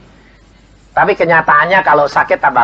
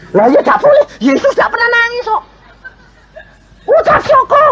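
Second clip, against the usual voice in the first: much higher, a put-on preachy sing-song; mocking pious voice. Preacher mocks a pious adviser with a high put-on voice. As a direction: parody the know-it-all who forbids crying.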